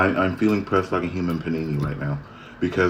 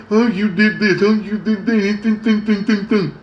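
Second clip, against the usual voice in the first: much higher, louder whiny mock voice; mocking accusers; 14 semitones higher, 5 dB louder than usual. Mocking people who blame her, high whiny voice. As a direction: parody the people pointing fingers. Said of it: "point fingers at me, saying".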